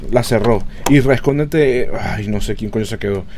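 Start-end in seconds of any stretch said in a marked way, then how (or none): 0.9-1.9 urgent shout. Real, not acted. Hiding from hunting ghost.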